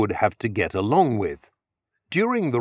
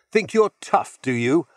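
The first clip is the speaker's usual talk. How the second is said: higher, louder jeering challenge; bully loudly taunting Arnie in the schoolyard; 5 semitones higher, 3 dB louder than usual. A narrator's reading. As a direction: loudly dare him, as if he started it. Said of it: "Otto said to him loudly".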